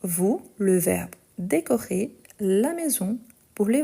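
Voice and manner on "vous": ordinary delivery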